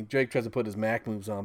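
usual speaking voice

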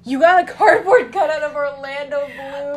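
loud, high, laughing question; laughing disbelief. Real, not acted. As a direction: crack up in disbelief.

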